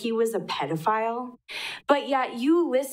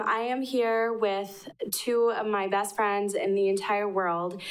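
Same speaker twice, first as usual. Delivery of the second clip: ordinary talk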